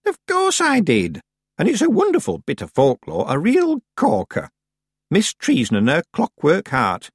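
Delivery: put-on high-pitched, proud old woman; gleeful old witch. Miss Treason delighting in her own legend, gleeful. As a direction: relish every word of the boast.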